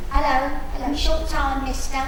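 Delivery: hammed-up Cockney come-on; Cockney prostitute. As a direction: call out to a passing customer. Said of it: "a Cockney p-, prostitute".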